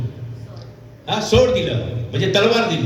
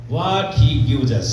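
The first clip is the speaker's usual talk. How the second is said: very slow, stretched-out words with a falling end; weighty slow-motion delivery; slow, deliberate; 3 semitones lower, much slower than usual. Real, not acted. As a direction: draw each word out for the congregation. Preacher spells out key question.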